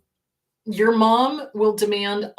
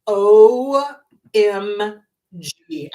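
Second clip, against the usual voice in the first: drawn out, loud and slow; exasperated OMG; 8 dB louder, much slower than usual. Retold or imitated. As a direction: stretch every letter, exasperated. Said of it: "you'll scream".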